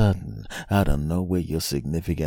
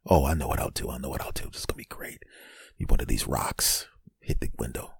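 low, very soft muttering to oneself; scheming whisper; 4 semitones lower, 8 dB quieter than usual. Acted. Stranded man planning to throw rocks, scheming whisper. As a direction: whisper the plan to yourself.

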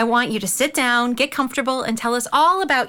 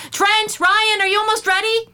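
calling upstairs, raised and high; 9 semitones higher than usual. Acted. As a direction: call the boys from downstairs.

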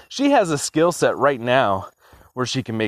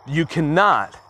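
usual speaking voice

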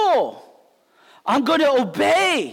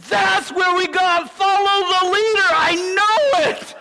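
eager guess; 6 semitones higher than usual. Retold or imitated. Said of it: "one guy raised his hands".

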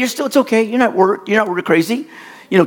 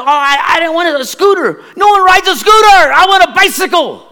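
put-on much higher, louder bawling kid; childish crying shout about the bike; retold crying; 12 semitones higher, 5 dB louder than usual. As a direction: wail it like a crushed boy. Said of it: "I started crying".

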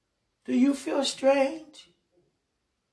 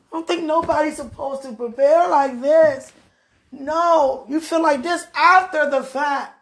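louder, higher agitated protest; distressed raised voice; upset outburst; 4 semitones higher, 8 dB louder than usual. Real, not acted.

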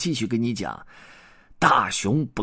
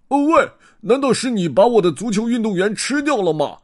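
high, loud accusing shout; angry bear roar; 14 semitones higher, 7 dB louder than usual. Acted. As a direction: bellow at the crow. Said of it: "布鲁姆博士吼了起来". (愤怒地高声吼叫)

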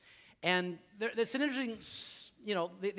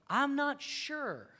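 slow, hesitant and a little higher; unsure; 6 semitones higher, 3 dB quieter, much slower than usual. Retold or imitated. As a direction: drag it out, lost in doubt. John doubting behind bars.

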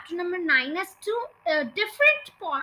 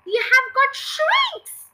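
loud, high, drawn-out proclamation; high and loud, stretching the words; crying out; 9 semitones higher, 7 dB louder, slower than usual. A narrator's reading. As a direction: cry out a dire verdict slowly. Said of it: "cried Mister Twit".

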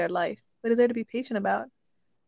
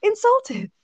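indignant emphasis; 12 semitones higher, 5 dB louder than usual. Real, not acted. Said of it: "I was so".

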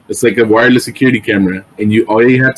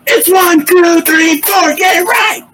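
very high mock shout, slowing at the end; shrieking cheer parent; slower than usual. Cheer parent drilling the count, hammed-up exasperation. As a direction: count it out in a strained, high yell.

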